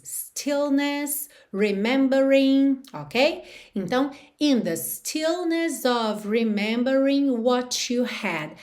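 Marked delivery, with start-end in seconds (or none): 4.4-8.5 slow demo. Shown as a demonstration. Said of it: "teacher reads song line slowly".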